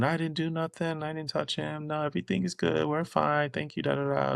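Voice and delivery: half-hearted imitation of her smooth-talking; pleading excuses. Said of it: "trying to talk her way out of it".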